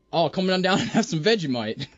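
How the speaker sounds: lightly put-on, clumsy Australian accent; a bad, half-hearted go at Australian. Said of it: "can you do an Australian accent".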